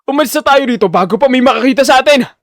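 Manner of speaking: loud, high, frantic yell to flee; panicked shouting. Acted. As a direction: shout it out in terror to get away. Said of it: "dahil sa takot".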